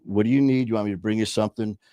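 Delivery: normal talking voice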